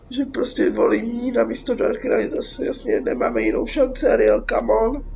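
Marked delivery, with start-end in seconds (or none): none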